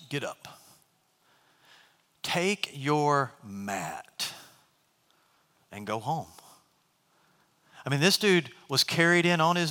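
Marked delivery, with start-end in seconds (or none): 0.1-6.3 lower, much softer, very slow; quiet command. Acted. Jesus telling the paralyzed man to rise, hushed and slow. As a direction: say it with calm, hushed authority.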